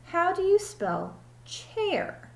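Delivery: high, slow ditzy teacher voice; drawn-out, high and relieved; silly teacher. Acted. Teacher greets Allison in the empty classroom, high and slow.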